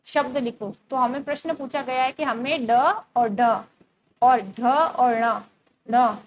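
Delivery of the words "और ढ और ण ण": ढ and ण said slowly and loudly, each letter drawn out; letter sounds demo. Shown as a demonstration. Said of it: "इसको क्या पढ़ेंगे".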